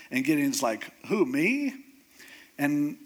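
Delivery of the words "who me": higher, questioning retort; lightly acted startled question; mild mock disbelief. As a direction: ask as if they must mean someone else. Gideon astonished at being chosen.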